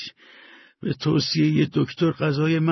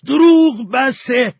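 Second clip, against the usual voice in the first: desperate shout; 11 semitones higher, 9 dB louder than usual. A narrator's reading. (فریاد بلند و کشیده از سر ناامیدی)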